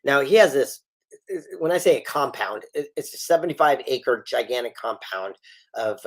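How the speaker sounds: ordinary talk, nothing marked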